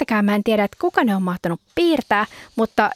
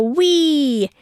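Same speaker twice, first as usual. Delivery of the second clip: high, gleeful wheee of a potato in flight; excited squeal; 6 semitones higher than usual. Acted. Potato on the plane to Finland, gleeful high squeal. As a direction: squeal it like a delighted kid on a plane.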